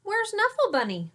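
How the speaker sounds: high, loud urgent question; mom's anxious high cry; mommy asking. Acted. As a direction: ask like an alarmed mom. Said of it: "she asked".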